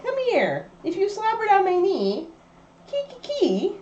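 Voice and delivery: high, sing-song pet voice. Real, not acted. Calling the dog Kiki.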